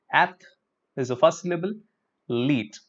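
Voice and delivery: ath, pause, leet, split in two; split into syllables. Shown as a demonstration. Teacher splitting athlete for students. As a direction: break athlete into two beats. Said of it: "So you break it up like this".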